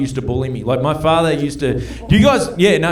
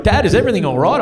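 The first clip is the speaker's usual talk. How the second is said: put-on worried kids; 6 semitones higher, 3 dB louder, faster than usual. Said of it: "they come into Landry and".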